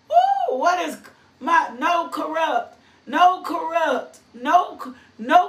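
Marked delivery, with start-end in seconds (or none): 0.1-0.6 a laugh breaking into a high woo; laughing whoop. Real, not acted. Preacher laughs and exclaims.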